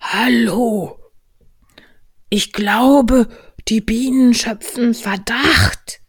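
high, loud stage voice; conspiratorial bear. Acted. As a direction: confide it urgently from up high. Pooh warns of suspicious bees. (hohe, verschwörerische Bärenstimme)

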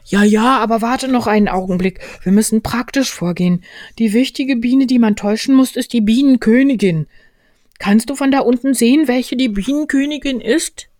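high, loud bear, busily planning; Winnie der Pooh. Acted. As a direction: give instructions from up high. Voices Pooh in story. (hohe, geschäftige Bärenstimme)

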